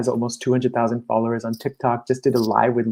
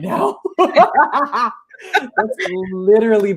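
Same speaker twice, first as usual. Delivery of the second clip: said through a laugh, higher; 10 semitones higher, 4 dB louder than usual. Real, not acted. Coach admitting her own struggle, said laughing. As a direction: laugh at yourself while admitting it.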